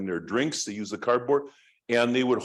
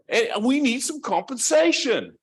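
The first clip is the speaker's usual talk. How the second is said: high, loud put-on Aussie accent; hammed-up Australian complaint; best Australian accent; 12 semitones higher, 5 dB louder than usual. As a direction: demand it in a mock Australian accent, pitched way up. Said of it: "trying to do my best Australian accent".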